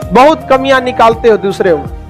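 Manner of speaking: ordinary delivery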